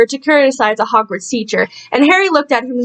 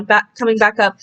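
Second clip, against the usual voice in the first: usual speaking voice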